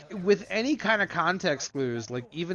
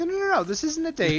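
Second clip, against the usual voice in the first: much higher, fast, panicky protest; flustered denial; 12 semitones higher, faster than usual. Retold or imitated. Gambit frantically denies the date to the girl. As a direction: backpedal in a frantic rush.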